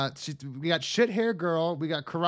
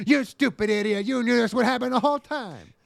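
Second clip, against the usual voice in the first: high, loud furious anime yell; anime rage; 9 semitones higher, 5 dB louder than usual. Pretend. Anime girl yells at her clueless friend. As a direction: scream it like a monster-toothed heroine.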